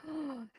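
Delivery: soft mock-impressed gasp; admiring gasp. Teacher pretends to squeeze a guy's muscle, awed gasp.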